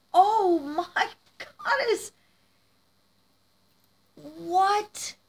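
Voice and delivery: high, drawn-out disbelief; slow awed cry rising very high; overwhelmed amazement. Real, not acted. Gift recipient seeing the figure, amazed.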